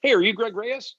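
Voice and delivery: a guy pleased to find him at last; imitating an eager stranger. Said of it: "this guy comes up to me and goes".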